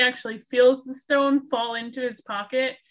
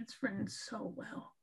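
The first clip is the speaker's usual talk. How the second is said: much softer murmured echo; half to herself, barely voiced; quiet; 16 dB quieter than usual. Real, not acted. Soft echo of own remark.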